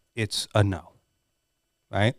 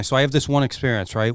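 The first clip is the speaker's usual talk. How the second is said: ordinary delivery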